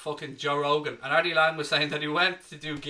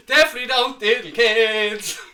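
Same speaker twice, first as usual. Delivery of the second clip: blurted out through laughter, higher and louder; laughing; 7 semitones higher, 10 dB louder than usual. Real, not acted. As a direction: laugh it out while backpedalling. Laughing at own awkward joke.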